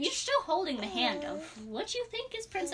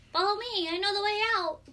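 a hint of a princess, pitched higher; lightly voiced princess; 7 semitones higher than usual. Game master as princess luring player, lightly voiced higher. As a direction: lightly voice the young guide, higher.

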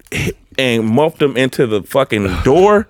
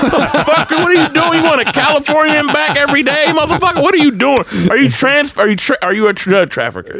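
high-pitched incredulous interrogation; exasperated rant. Retold or imitated. Grilling the renter over all those miles, exasperated. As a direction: fire off outraged questions, voice way up.

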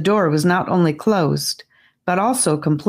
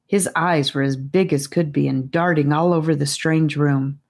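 ordinary delivery, nothing marked

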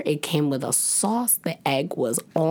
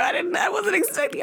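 very high breathless squeal; flustered squeal; faster than usual. Retold or imitated. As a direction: squeal caught off guard. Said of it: "I'm flustered".

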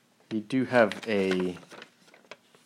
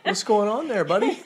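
higher, laughing, teasing question; laughing tease; 9 semitones higher than usual. Retold or imitated. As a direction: tease a buddy through a laugh. Coworkers teasing at lunch table.

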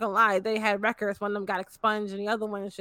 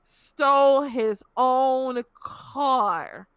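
slow, halting, incredulous quote; retold reaction to an absurd excuse; stunned disbelief; much slower than usual. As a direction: drag it out, pausing hard before the last word. Stunned reply to cops' flimsy excuse.

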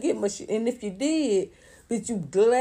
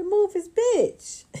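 Tyler Perry voice; 12 semitones higher, 3 dB louder, much slower than usual. Retold or imitated. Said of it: "talking like Tyler Perry".

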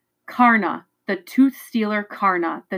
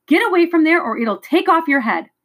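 urgent warning; 8 semitones higher, 4 dB louder than usual. A narrator's reading. Warning child of swooping beast.

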